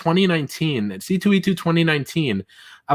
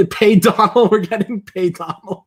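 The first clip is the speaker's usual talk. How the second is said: laughing; 4 semitones higher, 3 dB louder than usual. Real, not acted. Chanting the chat joke, laughing.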